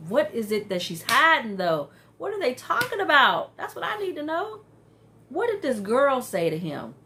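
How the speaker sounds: dying to know; excited curiosity. Real, not acted. Eager about the mystery.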